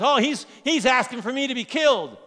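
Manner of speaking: hammed-up offended candidate; mock outraged politician. Preacher mocking the candidate's reaction, in mock outrage. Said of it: "The political candidate says".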